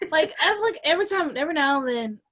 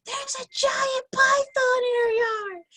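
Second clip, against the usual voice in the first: mock excited kid voice; slower than usual. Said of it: "And he's like".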